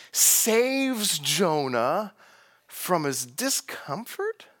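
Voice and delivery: "saves" stretched out very slowly; very slow stress on saves. Shown as a demonstration. Preacher pointing out the narrator's ironic word. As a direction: linger on "saves Jonah". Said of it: "narrator uses this word salvation".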